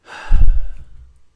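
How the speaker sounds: big loud exasperated exhale; a loud, shaky breath out in the middle of an emotional rant; loud sigh. Real, not acted. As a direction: let out a heavy, loud sigh. Overwhelmed mid-rant.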